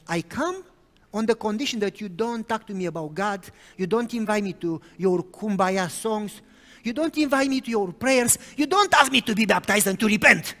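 defiant demands. Retold or imitated. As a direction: list her terms with mounting defiance. Girl laying down angry conditions.

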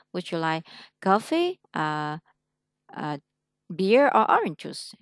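slow, each choice rising, "orange juice" falling; list tune up up down. Shown as a demonstration. Teacher showing the choice-question tune to a student, up then down. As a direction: rise on "coffee", "tea", then drop on "orange juice". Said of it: "cái cuối cùng là xuống".

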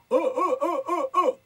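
high loud laughing cartoon bear oh; Yogi Bear voice. Shown as a demonstration. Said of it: "voz de oso yogi". (oh oh con voz de oso Yogi)

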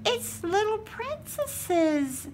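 high, slow delighted reveal; high slow happy reveal. Real, not acted. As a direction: show it off with delight. Delighted at princess gift.

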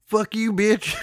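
high, hammed-up rural Virginia accent. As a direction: cuss in a cartoon Virginia twang. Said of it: "saying that in that accent".

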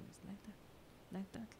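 hushed, breathy whisper, almost no voice; whispered demo. Retold or imitated. Describing trainers posing at the mirror, whispered. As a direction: breathe it out like a secret aside.

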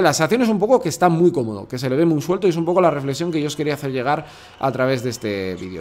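ordinary talk, nothing marked

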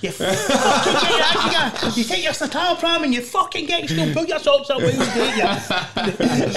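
high, laughing mock tough-love tirade; mock aggressive rant. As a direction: bark it like a hard man, half laughing. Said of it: "it would be hard for me to say".

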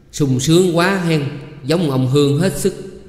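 slow, high, loud jibe at her husband; sarcastic taunt. A narrator's reading. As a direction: draw out the mock admiration. Irritated wife taunts her idle husband.

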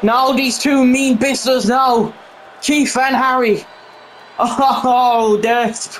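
hyped play-by-play of the match; wrestling commentator. Real, not acted. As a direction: call the action live.

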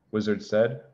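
plain reading voice

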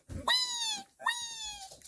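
very high, soft little squeak; baby squeal. Pretend. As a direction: squeak like a tiny toy baby. Baby toy voiced crying.

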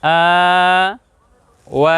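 আ and ওয়া each held long; lengthened vowels. Shown as a demonstration. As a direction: draw out আ, pause, draw out ওয়া. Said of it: "একটু লম্বা হবে".

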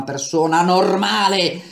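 a defiant shout. A narrator's reading. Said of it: "gridò Atarva".

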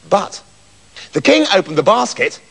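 ordinary delivery, nothing marked

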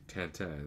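Tintin French-style, nasal tan-tan; French pronunciation. Shown as a demonstration. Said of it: "so I say Tintin".

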